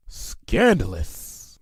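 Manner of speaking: drawn-out, slow scandalized remark; mock scandalized. A narrator's reading. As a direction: savour the word slowly. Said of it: "Lyra said".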